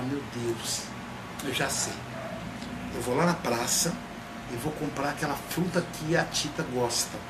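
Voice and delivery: soft, hushed plotting to herself; quiet scheming. Retold or imitated. Heloísa quietly planning the fruit trick, hushed scheming. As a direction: murmur the plan under your breath.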